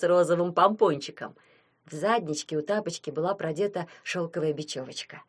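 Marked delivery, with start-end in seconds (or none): none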